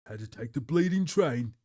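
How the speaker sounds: put-on Cockney grumble; proper Cockney. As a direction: try a broad Cockney accent. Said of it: "Proper Cockney?".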